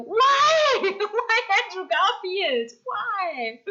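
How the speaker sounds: loud, high-pitched wail of protest; mock-outraged yell. Real, not acted. Streamer protesting a Spider-Man casting, yelling. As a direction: cry out in exaggerated disbelief, stretching it. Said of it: "yelled at you".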